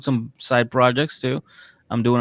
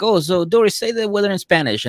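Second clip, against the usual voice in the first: mock agent request; 9 semitones higher, 3 dB louder than usual. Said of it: "he's like".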